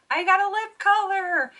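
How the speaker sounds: high, loud burst of joy; excited shout. Real, not acted. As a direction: cry out in delight at the win. Finally wins a lip product.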